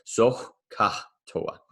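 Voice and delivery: Soh ka toa with a breathy rasped H in Soh, drawn out slowly; emphasized H's. Shown as a demonstration. Teacher demos the mnemonic to class, leaning on the H's. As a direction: push air on the H in Soh ka toa. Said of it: "I definitely try and emphasize the H's".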